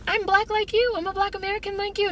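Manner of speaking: high-pitched mock claimant; mocking imitation. People suddenly claiming to be like us, mocking. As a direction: put on a high, mocking singsong.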